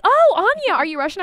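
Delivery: high-pitched imitated curiosity; mock amazement. Stranger mishearing her name, mock amazement.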